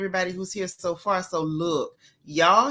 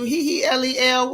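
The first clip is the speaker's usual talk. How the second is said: ordinary talk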